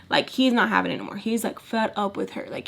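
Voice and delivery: ordinary talk, nothing marked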